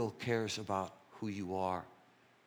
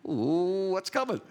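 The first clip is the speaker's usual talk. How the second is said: mock alarm; 9 semitones higher, 8 dB louder, slower than usual. Retelling his alarm at her tone.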